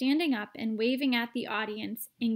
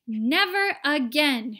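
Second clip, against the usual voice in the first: much louder, slow firm declaration; shouted; 3 semitones higher, 8 dB louder, slower than usual. A narrator's reading. Father refusing his son television, slow shout. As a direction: shout it down flatly. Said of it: "shouted Mister T V".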